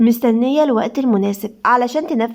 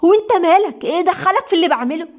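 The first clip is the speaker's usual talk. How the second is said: high, quick, exasperated retort; irritated; 7 semitones higher than usual. A narrator's reading. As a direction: fire back, nervy and annoyed. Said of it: "فيروز بعصبيه وغيظ". (بعصبية وغيظ)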